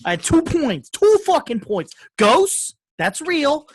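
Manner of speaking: loud, high-pitched mock-indignant protest; insistent outburst. Real, not acted. Interrupted podcaster demands his two points, insistent outburst. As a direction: cut back in loudly, hammering each point.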